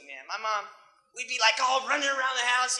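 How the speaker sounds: ordinary talk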